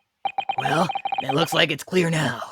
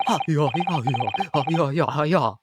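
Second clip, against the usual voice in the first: fake foreign language; 2 semitones lower than usual. Witness answers in gibberish.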